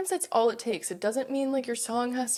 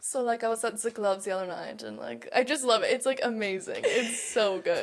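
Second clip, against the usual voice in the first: guest answering in a laughing mock German accent. Guest answers the interviewer in a playful German accent. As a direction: play a German clubgoer, half laughing. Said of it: "the German accent has a grip on me".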